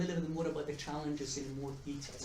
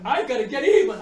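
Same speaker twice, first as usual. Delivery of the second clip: much louder, higher mock-furious vow; pissed wife; 10 semitones higher, 21 dB louder than usual. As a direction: play the wife swearing revenge. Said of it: "she was pissed".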